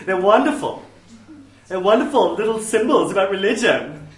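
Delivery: loud, lilting mock-Swedish gush; gushing minister gone Swedish; accidental Swedish accent. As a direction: slip into a hammed-up Swedish accent. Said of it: "I don't know why I went Swedish there".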